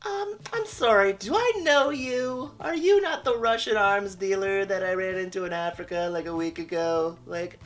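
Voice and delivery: a hammed-up high damsel voice; mocking Lois. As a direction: parody her as a clueless damsel. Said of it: "She walks up to a janitor".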